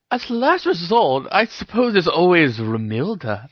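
high-pitched playful tease; teasing. A narrator's reading. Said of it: "she teased".

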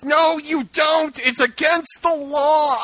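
high, slow, prim correction; prissy refusal. Retold or imitated. Mocking Harry to co-hosts, a slow prim scold. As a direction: drag out the rule like a scold. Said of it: "Hermione's like".

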